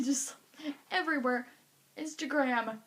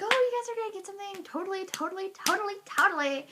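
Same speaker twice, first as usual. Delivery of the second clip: high, fast, bubbly promise; chirpy encouragement; 6 semitones higher, 3 dB louder, faster than usual. Retold or imitated. Girl to friends awaiting results, bubbly reassurance. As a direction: gush reassurance at her friends. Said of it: "and I was like".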